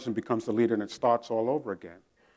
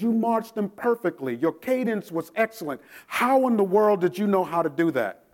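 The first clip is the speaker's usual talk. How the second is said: higher, amazed praise; amazed drill instructor; 6 semitones higher, 3 dB louder than usual. Retold or imitated. Drill instructor impressed by private's marching, mildly amazed. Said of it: "He said".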